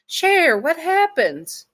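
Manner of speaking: high, loud cry of concern; alarmed mother. Acted. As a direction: call out in shock, voice leaping up and stretching the words. Mama sees son's bruised face.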